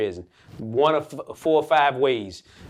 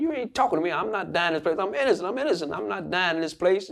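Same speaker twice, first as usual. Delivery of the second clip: mildly indignant, higher-pitched protest; retold angry defiance; 6 semitones higher than usual. Prisoner answering bigger inmates who say he'll die there, mildly angry. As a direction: push back in mild anger, pitch raised. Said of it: "'cause I was angry".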